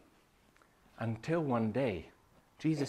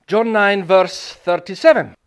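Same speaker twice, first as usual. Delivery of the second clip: John nine shouted much higher and louder; loud announcement; 6 semitones higher, 16 dB louder than usual. Shown as a demonstration. Preacher announcing the scripture, shouting. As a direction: call out the verse.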